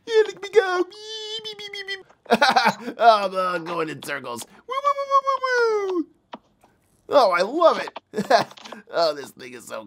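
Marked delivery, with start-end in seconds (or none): 0.8-2.0 pitched well up and much softer, toy-car beeps; tiny robot beeping as it zips around; high, soft beeping sound effect. Pretend. Voicing little rover driving. 4.7-6.1 pitched way up, playful siren-like woos; high playful woo sound effect. Pretend. Rover going in circles.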